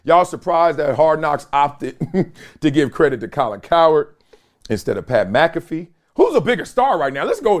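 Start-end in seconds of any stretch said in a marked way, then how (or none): none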